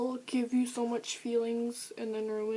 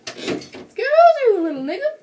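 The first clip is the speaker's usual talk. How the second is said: loud, high, sassy outrage; indignant outburst; 15 dB louder than usual. Real, not acted. Scolding a book character, a loud high sassy outburst. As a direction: snap back at the character in mock offense.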